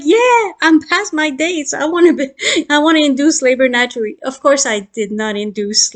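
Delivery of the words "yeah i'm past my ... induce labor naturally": high, raised-voice reply; lightly hammed-up protest; mock yelled retort. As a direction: re-enact calling back over a crowd. Acting out shouted reply to doctors.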